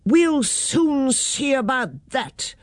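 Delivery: understated indignant warning; angry threat. A narrator's reading. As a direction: drag out the threat with restrained anger. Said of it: "looked very angry".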